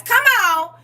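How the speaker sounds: high, loud joyful cry; excited shout. Real, not acted. Teacher reacting to student's note, loud joyful cry. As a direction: shout it out in delight.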